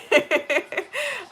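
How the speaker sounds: high trailing giggle; laughing. Real, not acted. Laughs between saying she's okay.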